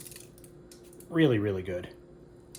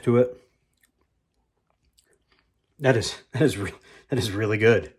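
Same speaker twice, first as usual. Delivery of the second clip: excited; 9 dB louder than usual. Real, not acted. Raving over the taste.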